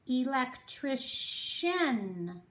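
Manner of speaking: "Electrician" said very slowly; slow demo word. Shown as a demonstration. Teacher presenting the word slowly. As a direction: stretch out "Electrician".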